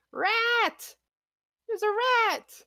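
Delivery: high, loud panicked cry; villager's scream. Acted. Game master as villager who just saw a rat, screaming. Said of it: "she's just gonna continue screaming".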